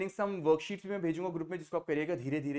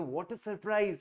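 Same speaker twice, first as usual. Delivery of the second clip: higher-pitched, stretched exclamation of amazement; expressive surprise; 4 semitones higher, much slower than usual. Shown as a demonstration. Teacher showing how to add emotion in English, surprised. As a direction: put clear feeling into the surprise. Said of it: "अंग्रेजी में कैसे भाव देंगे".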